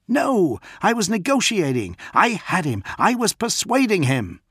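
man arguing his negotiation was working; indignant protest. A narrator's reading. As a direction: defend yourself, voice climbing. John protests hotly to Damon.

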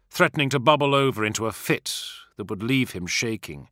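ordinary delivery, nothing marked

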